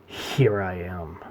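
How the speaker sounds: softly spoken, drawn-out announcement; low, slow, hushed proclamation; commanding declaration. Retold or imitated. Joker announcing himself to the world, commanding but hushed. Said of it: "grabbed the world by the throat and said".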